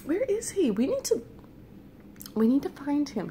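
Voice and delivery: high-pitched anxious questioning; worried urgency. Retold or imitated. Dreamer worried about the rejected friend, high and anxious.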